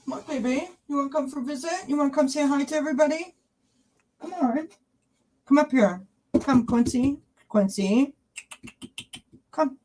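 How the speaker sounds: pet-coaxing voice. Real, not acted. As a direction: coax a beloved pet in a high-pitched voice. Coaxing her dog to come.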